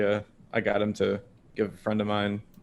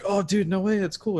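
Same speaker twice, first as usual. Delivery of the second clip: imitated impressed excitement; 9 semitones higher than usual. Coworker's impressed reaction, retold.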